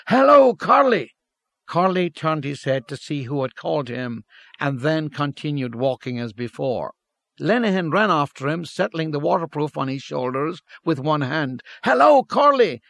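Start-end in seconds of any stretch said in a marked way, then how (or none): 0.1-1.1 loud high call across the street. A narrator's reading. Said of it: "He called out". 11.8-12.8 loud high call after a friend; crying out. A narrator's reading. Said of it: "he cried again".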